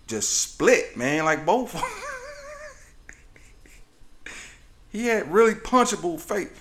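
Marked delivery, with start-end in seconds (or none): 1.8-4.8 long, breathy wheeze of laughter; wheezing laugh. Real, not acted. Comic amused by his own joke, long wheezing laugh. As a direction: laugh until it turns to a wheeze.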